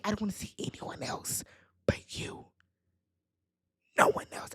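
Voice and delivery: soft, unvoiced creeper impression; creepy whisper. Man tells his date she's the only one, creepy mock whisper. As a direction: whisper it like a creeper, with lingering pauses. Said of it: "but it's giving a little creeper".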